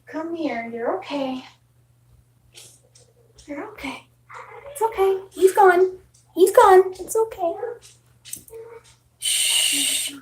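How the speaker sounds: calm the frightened cat gently; comforting the scared kitty, softly; soothing pet talk. Real, not acted.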